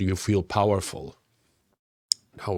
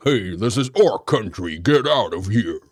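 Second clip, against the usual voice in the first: jaw-first tough guy; 2 semitones lower, 3 dB louder than usual. Acted. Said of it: "Maybe I'm protruding with my jaw and I'm like".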